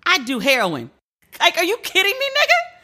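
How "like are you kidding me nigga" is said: high, incredulous squeal of disbelief; mock disbelief. Comic turning down an eighteen million offer, mock disbelief. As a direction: act stunned at the offer.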